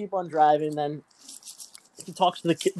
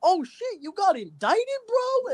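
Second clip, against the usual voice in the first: much higher, excited mock shout of surprise; imitating excited passenger; 14 semitones higher, slower than usual. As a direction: pitch it way up like him.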